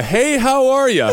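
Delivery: high, loud jokey holler; mock big greeting. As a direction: tease with an over-the-top hello. Repeats the big greeting, teasing.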